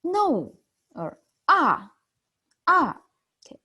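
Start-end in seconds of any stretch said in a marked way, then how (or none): 0.0-0.5 "No" falling sharply from high; a short mock-angry "No", pitched high and dropped; fourth tone, angry. Shown as a demonstration. Teacher demonstrates the angry sound, high and falling. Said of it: "angry sound". 1.5-3.0 "ah" starting high and falling sharply; fourth tone, angry. Shown as a demonstration. Teacher demonstrates the angry sound, high and falling. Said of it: "angry sound".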